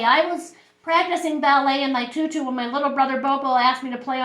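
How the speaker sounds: put-on little girl earnestly accounting for herself; schoolgirl Lulu. Voices Lulu recounting her day.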